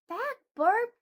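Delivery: high, loud little cry of back burp; flower girl shouting at the burp; shouted. Acted. Flower girl shouts the stink away, loud and high. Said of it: "the flower girl shouted".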